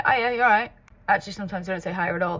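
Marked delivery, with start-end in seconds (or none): 0.0-0.7 quick Southport greeting, louder; Southport accent. Shown as a demonstration. Vlogger showing how her hometown says hello, Southport accent. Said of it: "they say".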